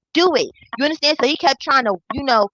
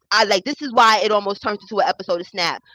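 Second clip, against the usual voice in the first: ordinary talk, nothing marked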